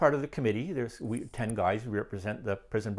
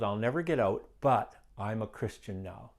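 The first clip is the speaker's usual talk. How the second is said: normal talking voice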